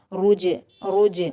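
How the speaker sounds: "रुज" said loudly with an echoing final j; qalqala bounce. Shown as a demonstration. Teacher demonstrating qalqala on jeem, bounced "रुज". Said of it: "कलकला से पढ़ा जाएगा".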